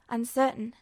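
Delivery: ordinary delivery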